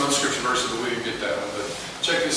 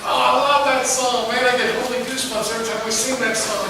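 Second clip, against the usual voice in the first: higher, gushing fan of a worship song; gushing churchgoer; 8 semitones higher than usual. Retold or imitated. Churchgoer to pastor, gushing over a favorite song, higher voice. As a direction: gush like a churchgoer hearing their favorite song. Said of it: "You heard that?".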